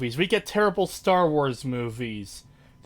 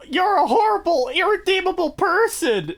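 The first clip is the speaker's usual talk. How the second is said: high, loud scolding squeak; mock cricket outrage, much higher; outraged cricket; 13 semitones higher, 3 dB louder than usual. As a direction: scold him like a furious tiny cricket. Cricket scolding Jack Horner.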